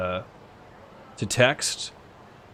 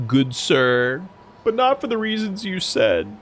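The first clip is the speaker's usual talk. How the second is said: normal talking voice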